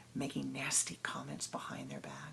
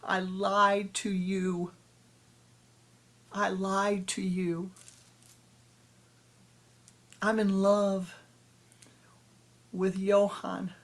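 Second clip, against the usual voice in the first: louder, slow, tearful confession; breaking down; 4 semitones higher, 6 dB louder, slower than usual. Acted. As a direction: pour out the apology as she falls apart. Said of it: "broke down and said".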